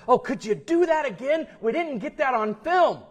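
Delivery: high, loud reporter's plea; excitedly acting out an eager reporter; eager request. Reporter eagerly begs minister for retake.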